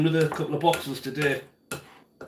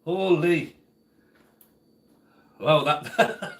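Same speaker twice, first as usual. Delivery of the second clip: usual speaking voice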